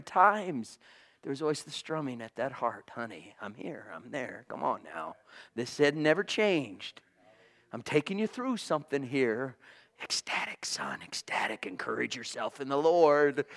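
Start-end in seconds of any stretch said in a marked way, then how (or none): 2.9-4.4 soft, low tender reassurance; tender hush. Acted. Voicing God comforting him. 4.5-5.2 soft, low coaxing murmur; gentle coaxing. Acted. 5.5-9.6 soft, low tender reassurance; tender hush. Acted. Voicing God comforting him. 10.0-13.5 low, unvoiced urging whisper; whispered encouragement.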